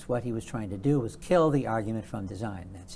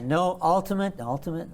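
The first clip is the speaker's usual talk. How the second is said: "ultimate" repeated for emphasis; stress on "ultimate"; 5 semitones higher than usual. Real, not acted. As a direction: lean on "ultimate", then say it once more. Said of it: "let me stress that word".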